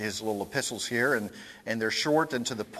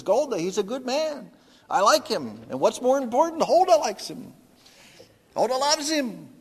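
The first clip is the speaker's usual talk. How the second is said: much higher, earnest husband; pleading Tevye; 11 semitones higher, 5 dB louder than usual. Retold or imitated. Tevye defends the suitor to Golda, high and earnest. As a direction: plead the suitor's case to his wife. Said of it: "And he says".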